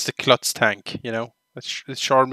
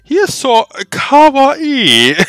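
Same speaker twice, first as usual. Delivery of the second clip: put-on cute anime girl; 6 semitones higher, 5 dB louder than usual. Girl character giggles about sergeant.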